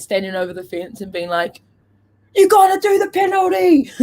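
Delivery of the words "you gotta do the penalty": heckling shout. Acted. Co-host imagining policing celebrities from the fence, shouting. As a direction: holler it like an overzealous official.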